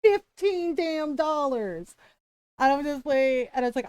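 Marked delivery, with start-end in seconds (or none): 0.0-2.0 raised-pitch indignant rant, slowing at the end; mock angry tirade; popping off. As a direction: imitate an outraged customer, stretching the final words. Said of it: "my husband popping off on this girl".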